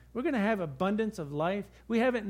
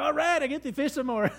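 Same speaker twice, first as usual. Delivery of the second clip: upbeat, higher and louder; cheerful; 7 semitones higher, 4 dB louder than usual. Retold or imitated. Angler cheerfully shrugs off the lost fish, upbeat and loud. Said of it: "And I go".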